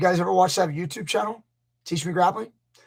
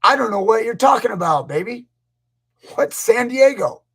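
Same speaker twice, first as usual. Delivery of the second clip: mock wail, high and loud; high, loud, hammed-up distress; retold tearful outburst; 5 semitones higher, 6 dB louder than usual. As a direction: wail it in confusion, imitating a meltdown. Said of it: "looked at her and started crying".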